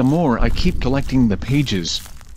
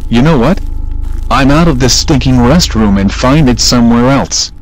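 angry; 4 dB louder than usual. Acted. Fred rages at the game.

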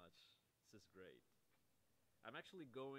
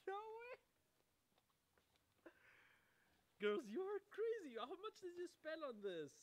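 much higher and louder, a thrilled outburst; ecstatic shouting; 11 dB louder than usual. Real, not acted. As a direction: shout your joy at the gift. Overjoyed at the friends' gift.

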